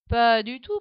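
loud protest in chorus. A narrator's reading. Adi and Doudou protesting together, loud. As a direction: fire back a loud denial. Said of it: "protestent Adi et Doudou en chœur".

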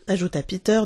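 ordinary delivery, nothing marked

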